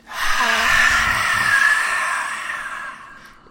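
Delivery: loud forceful breathy roar out; lion's breath exhale. Shown as a demonstration. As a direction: blast the air out like a lion. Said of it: "a nice lion's breath out".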